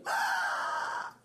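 put-on a burnt kid's sharp "ah"; child's yelp. Child touching the hot thing: imitated yelp.

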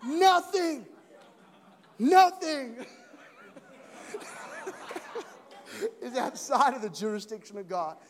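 preacher laughing while repeating nothing loudly; laughing preaching. Real, not acted. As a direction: proclaim through laughter. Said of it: "the nothing was really loud".

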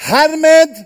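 high, loud royal announcement; proclaiming. Acted. The king begins his decree to the realm, loud. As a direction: open the decree grandly.

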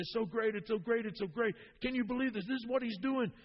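high, eager gushing; bubbling over with joy; retold excited exclamation. Excited convert retold in Brazil.

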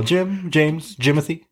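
mock Michael Scott. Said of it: "He's all".

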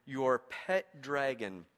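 "your pet dragon" drawn out slow and low; savoured slowly. Shown as a demonstration. Preacher savouring the Message translation, slow and low. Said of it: "I love the way that Eugene Peterson says this".

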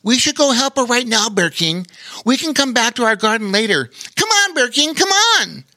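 very high, urgent little otter; squeaky otter voice. A narrator's reading. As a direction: hurry them along, squeaking. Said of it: "Bobo Chico quickly agreed".

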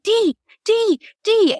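put-on very high, repeated baby calls; excited baby voice. Baby daughter in the shelter spotting a dog, excited squeal. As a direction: imitate a thrilled baby pointing out a dog. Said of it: "she's like".

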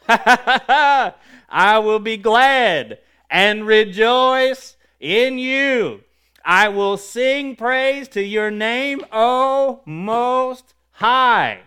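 exultant shout, very slow and high; emphatic proclamation. Real, not acted. Preacher praising the Most High from a psalm, emphatic proclamation. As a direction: shout each phrase out, holding the key words. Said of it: "state something emphatically".

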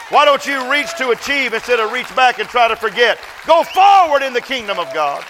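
high, loud preaching cry; full-force preaching. Acted. Preacher calling the church forward, loud and high. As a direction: preach it out over the congregation.

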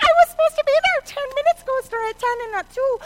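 high, loud, rushed panic; acted-out flustered mom running late; frantic. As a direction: blurt it out in a hurry. Late mother shouting while dressing.

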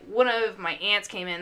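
normal talking voice

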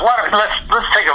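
high, halting retold proposal; agitated suggestion. Musician to exasperated conductor, agitated false start.

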